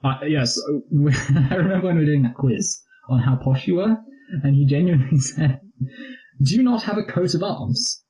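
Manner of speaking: a hint of a posh English mate; posh imitation of Ben. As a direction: imitate a posh friend, rising to disbelief. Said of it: "he was always like".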